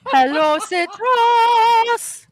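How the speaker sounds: high playful shouted hello; copied greeting call. Retold or imitated. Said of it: "Oh, nice one, missus".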